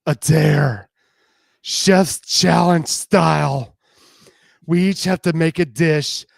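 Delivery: loud, gleeful daring challenge; gleeful taunting dare; wicked excitement. Acted. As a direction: throw down the dare with relish. Justine proposes dare, wicked grin.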